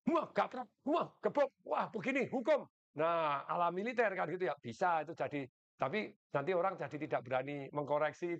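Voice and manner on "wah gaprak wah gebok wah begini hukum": raised, pitched-up barking orders; mock military boss. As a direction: play the harsh commander ruling by fear. Harsh boss hitting and punishing.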